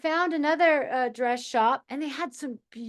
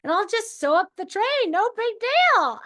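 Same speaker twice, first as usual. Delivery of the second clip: much higher and louder, thrilled at the idea; excited idea; 11 semitones higher, 4 dB louder than usual. Retold or imitated. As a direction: squeal with delight at the plan. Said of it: "And I'm just like".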